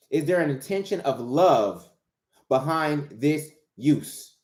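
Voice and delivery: slow, with a pause before "behind this use"; slow, emphatic repeat. Real, not acted. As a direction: draw out "behind this use", word by word. Question repeated slowly for emphasis.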